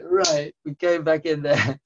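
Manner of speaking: high, loud retold battle cry; fired-up kid charging back in, hammed up; empowered boy. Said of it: "he was getting empowered".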